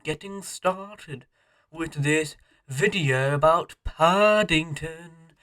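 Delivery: slow, soft posh British voice; drawn-out upper-class British accent. Acted. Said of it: "Why on earth did you sound like a posh British thing, British character?".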